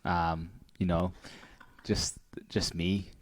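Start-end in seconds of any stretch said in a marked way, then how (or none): none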